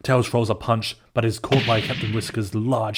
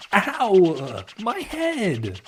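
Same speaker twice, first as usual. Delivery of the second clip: high, sharp cry of pain; 10 semitones higher, 3 dB louder, much slower than usual. Acted. Marine slammed into a tree, crying out in pain. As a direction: yelp as the head hits wood. Hits head on tree base.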